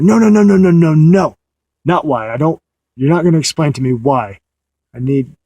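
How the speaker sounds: loud, high, insistent string of no's; aggressive refusal. Retold or imitated. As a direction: cut her off hard and shut it down. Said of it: "kind of aggressive".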